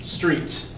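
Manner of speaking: Street alone, breathed out; stress on Street. Shown as a demonstration. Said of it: "Yep, we stress street".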